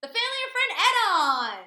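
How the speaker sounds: booming excited announcer reveal; game show host. Acted. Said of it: "Did I sound like a game show host?".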